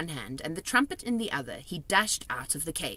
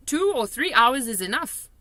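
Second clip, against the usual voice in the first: higher, louder friendly giant voice; kindly giant, raised voice; BFG giant voice; 8 semitones higher, 8 dB louder than usual. A narrator's reading. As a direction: play the big friendly giant, chatty and odd.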